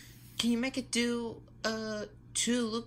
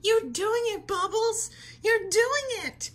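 loud, high, thrilled cheer; excited cheering; 11 semitones higher, 7 dB louder, slower than usual. Acted. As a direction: cheer her on at the top of your voice. Cheering kid flying kite.

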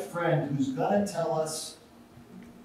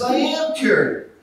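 excited; 11 semitones higher, 8 dB louder than usual. Retold or imitated. Punchline, guy cheerfully defiant.

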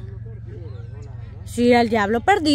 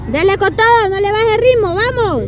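alentando a todo pulmón; empújalo con la voz en alto; grito de ánimo; 6 semitones higher, 5 dB louder than usual. Real, not acted. Animando al corredor, gritando. (grito de ánimo)

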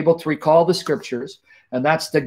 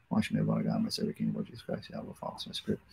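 much softer, hushed and fading to a whisper; whispered prayer; 16 dB quieter, faster than usual. Real, not acted. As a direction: breathe the words to God.

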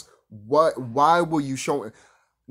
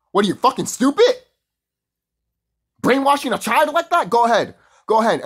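higher, louder scolding; angry rant; 8 semitones higher, 6 dB louder than usual. Real, not acted. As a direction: rip into them. Ranting at believers.

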